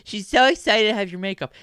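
high, excited mock-sister voice; gushing sister. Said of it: "and she's like".

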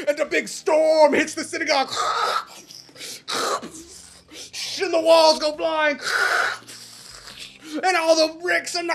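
high, loud, urgent telling; climactic storm narration. A narrator's reading. Storyteller tears down the synagogue, loud high drama. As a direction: shout it over the imagined storm.

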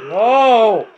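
loud, high desperate no; real gamer despair; anguished shout. Real, not acted. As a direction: scream it as the round slips away. Player losing the round.

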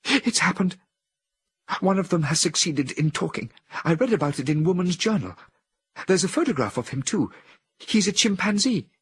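a woman in a trance, fearful of the apes; anxious woman. A narrator's reading. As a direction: warn of the danger with rising dread. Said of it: "the woman's voice continued in anguish".

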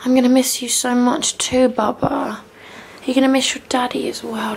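ordinary talk, nothing marked

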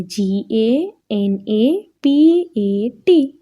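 letters spelt out slowly one by one; slow letter-by-letter spelling. Shown as a demonstration. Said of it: "ગણપતને અંગ્રેજીમાં શું લખાય નામ?".

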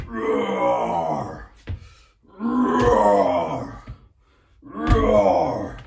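big demo lion roar. Shown as a demonstration. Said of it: "Can you show me your roar as well?".

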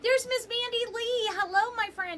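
put-on high, sing-song greeting to a child; kindergarten teacher voice. Cheery greeting of a viewer.

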